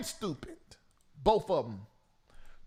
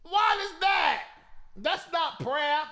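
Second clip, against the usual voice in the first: incredulous; 7 semitones higher, slower than usual. Real, not acted. Host mocking the prayer call.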